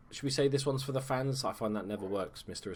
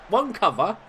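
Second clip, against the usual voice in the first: higher-pitched mock on-pitch call; acted defender's call; 9 semitones higher, 5 dB louder than usual. One defender telling another who covers, mock high call. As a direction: call out instructions like a teammate. Voices what defenders should have said.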